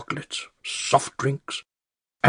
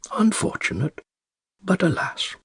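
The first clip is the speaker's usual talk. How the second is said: much higher, airy mock lament; mock regretful shrug; 12 semitones higher than usual. Old man mocking the care home director's excuse, sarcastic. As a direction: sigh it out as a sarcastic fake pity.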